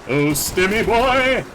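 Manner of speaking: slow high delighted cry; excited call. Real, not acted. Greeting a pickup fondly.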